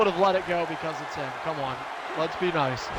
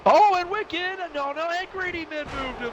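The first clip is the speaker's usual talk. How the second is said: excited outburst; 8 semitones higher, 4 dB louder than usual. Real, not acted. Wrestling commentary, sudden reversal.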